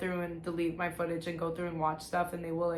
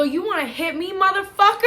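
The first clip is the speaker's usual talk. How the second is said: loud, high challenge building to a shout; retold furious outburst, rising in pitch; defiant yell; 11 semitones higher, 8 dB louder than usual. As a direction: shout back as if daring him, acting it out. Retold defiant shout at her dad.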